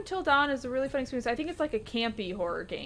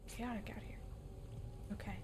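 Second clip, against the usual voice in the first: whispering; 17 dB quieter than usual. Real, not acted. Whispered aside during fight.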